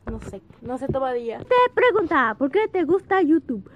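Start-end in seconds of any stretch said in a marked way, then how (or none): 1.4-3.7 loud reading. Real, not acted. Reading question loudly.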